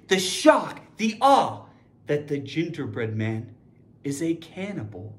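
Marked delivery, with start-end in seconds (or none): 2.1-5.1 hushed. Shown as a demonstration. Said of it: "emphasis on the whole situation".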